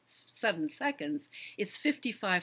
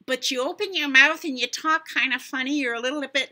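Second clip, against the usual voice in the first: higher, much louder, goofy exaggerated voice; exaggerated funny talk; 7 semitones higher, 8 dB louder, faster than usual. Shown as a demonstration. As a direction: ham up a funny-sounding speaker on purpose. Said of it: "now I'm exaggerating just to exaggerate".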